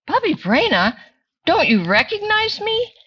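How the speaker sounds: high, surprised voice; rabbi voice. Acted. Rabbi asking Bubby if she knows him, high and surprised. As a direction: sound puzzled and hurt.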